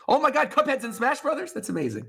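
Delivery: high, hammed-up amazed gasp; overplayed shock for the camera; reaction video. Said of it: "I'm gonna do my reaction face again".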